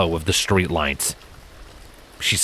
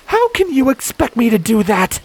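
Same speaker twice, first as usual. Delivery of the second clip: much higher, louder challenge; demanding; 5 dB louder than usual. A narrator's reading. Scarlet confronting Silent to shield the filly, high loud demand. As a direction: throw it back at him. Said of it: "Scarlet demanded".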